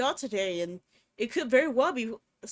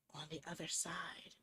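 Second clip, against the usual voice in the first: hushed, breathy narration; whispered creepy narration; 6 semitones lower, 15 dB quieter than usual. A narrator's reading. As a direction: drop to a whisper for suspense.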